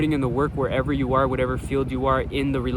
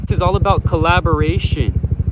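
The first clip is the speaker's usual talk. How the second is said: loud, raised voice over a helicopter; yelling over helicopter; 4 semitones higher, 9 dB louder than usual. Real, not acted. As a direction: shout to be heard over the rotor noise. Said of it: "Excuse the helicopter".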